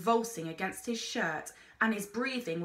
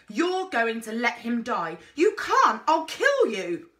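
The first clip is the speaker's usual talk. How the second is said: very loud, high frantic threat; raging, losing control; wild fury; 3 semitones higher, 8 dB louder than usual. A narrator's reading. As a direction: scream at him in desperation. Girl's desperate threat.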